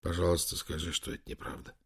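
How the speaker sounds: soft, fading, unvoiced plea; whispered plea. A narrator's reading. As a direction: beg in a whisper. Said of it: "прошептал Лэнгдон". (умоляющим шёпотом)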